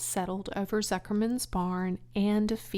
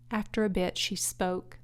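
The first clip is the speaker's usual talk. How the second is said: plain reading voice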